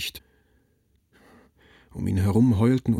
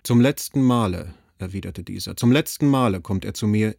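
louder, pressing on "letzten"; a grave promise made with weight; father vowing to his wife that it is the last time; firm vow; 4 dB louder than usual. Acted.

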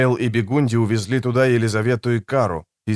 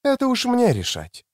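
high-pitched voice for a woman; higher female character voice; woman's voice; 11 semitones higher than usual. A narrator's reading.

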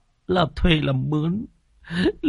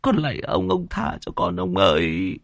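higher, tearful pleading; whimpering plea; 10 semitones higher than usual. Acted. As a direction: beg the landlord near tears. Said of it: "Anh Khoai mếu máo". (mếu máo van xin)

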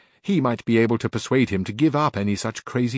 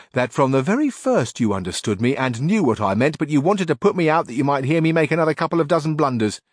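higher, louder simple peasant squire; earnest bumbling squire; Sancho Panza; 5 semitones higher, 3 dB louder than usual. A narrator's reading. Sancho tells his master his wife agreed, loud and eager.

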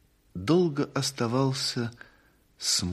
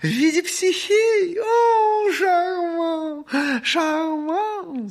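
very high, loud gushing cries; delighted shriek; 8 dB louder, much slower than usual. Acted. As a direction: shriek with affected rapture. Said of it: "сказала мать, улыбнувшись".

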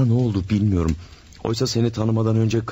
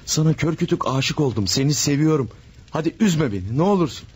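pleading; 6 semitones higher than usual. Acted. Jose begging Manuela.

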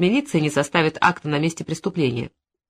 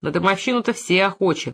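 plain reading voice

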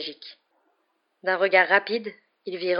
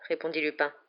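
plain reading voice

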